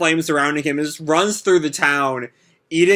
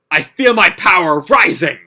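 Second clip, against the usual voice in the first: louder hyped cry; mild power-up shout; 3 semitones higher, 7 dB louder than usual. Acted. Natsu powering up.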